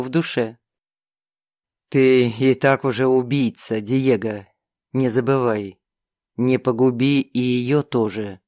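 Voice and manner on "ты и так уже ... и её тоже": low, fading demon warning; fire demon. Acted. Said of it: "Голос демона стихал, словно гроза проходила". (затихающий голос огненного демона)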